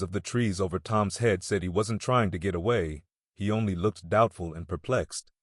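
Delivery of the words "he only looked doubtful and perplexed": ordinary delivery, nothing marked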